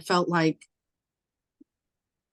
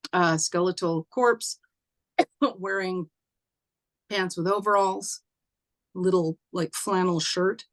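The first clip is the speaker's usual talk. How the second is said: ordinary delivery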